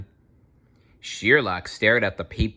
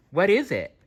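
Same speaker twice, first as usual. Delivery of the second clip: much higher eager voice for Watson; 11 semitones higher than usual. A narrator's reading. Watson asking Sherlock what happened, in a high voice. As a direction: lift the pitch right up, curious. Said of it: "asked Watson".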